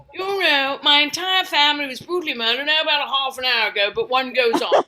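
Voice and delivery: mock English understatement; stiff-upper-lip English. English person recounting family tragedy, stiff-upper-lip English accent. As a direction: carry on composedly in an English accent. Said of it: "keep a stiff upper lip".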